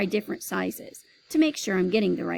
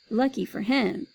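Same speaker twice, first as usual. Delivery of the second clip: ordinary delivery, nothing marked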